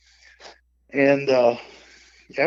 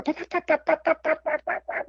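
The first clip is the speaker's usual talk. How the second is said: mock turkey call. Imitating turkey call sounds.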